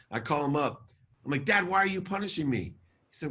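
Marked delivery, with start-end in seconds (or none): 1.4-2.7 high-pitched, pleading accusation; retold indignation. Son calling his dad about the present, indignant. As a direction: demand an answer, re-enacting hurt outrage. Confronts father in retold call.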